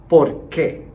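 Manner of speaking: each question word said slowly and loud, falling; clear model pronunciation. Shown as a demonstration. Teacher to students repeating after him, slow loud model words. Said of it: "Please repeat after me".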